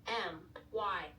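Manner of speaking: plain reading voice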